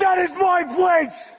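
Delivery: drawn out, high and furious; slow angry shout. Real, not acted. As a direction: insist on it at the top of the voice. Yelling at officer.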